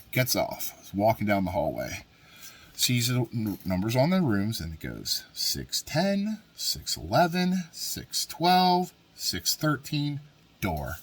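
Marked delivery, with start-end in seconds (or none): 5.3-10.2 slow count. Retold or imitated. Counting rooms in disbelief.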